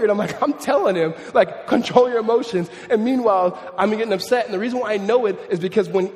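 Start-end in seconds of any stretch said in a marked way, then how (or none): 1.7-2.7 higher, louder mock scolding; acted-out exasperation; angry parent. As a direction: order it like a frustrated dad. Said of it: "I'm just getting so angry".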